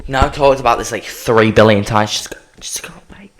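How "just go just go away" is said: breathy whispered plea, much softer. Acted. Begging the heat wave to leave, in a whisper. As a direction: hush it away like a secret.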